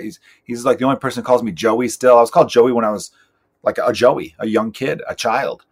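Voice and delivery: normal talking voice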